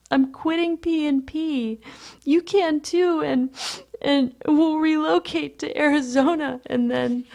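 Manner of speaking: high, whining desperate plea; frantic pleading. A narrator's reading. Lewis begs Patrick to run away, pleading. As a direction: plead in a high voice, stumbling onward.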